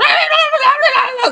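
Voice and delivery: high, frantic mock yapping; angry chihuahua yapping. Children heard squabbling from outside the store, angry chihuahua yapping. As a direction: yammer like a pack of tiny dogs. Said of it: "like a pack of angry chihuahuas".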